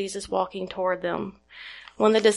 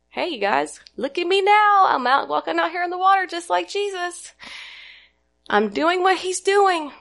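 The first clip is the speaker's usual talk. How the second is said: higher, boastful show-off calling to his friends; cocky show-off; 8 semitones higher than usual. Retold or imitated. Peter showing off to the disciples, cocky and boastful. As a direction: crow about it like a cocky kid. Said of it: "Peter wasn't a little maybe self-righteous or cocky".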